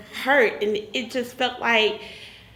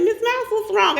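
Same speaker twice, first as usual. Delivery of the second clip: doctor's concern; 10 semitones higher than usual. Retold or imitated. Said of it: "she said".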